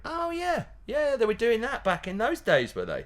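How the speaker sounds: high mock voice of a future listener. Future listener looking back at old slosh gags, mock high voice. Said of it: "they'll be going".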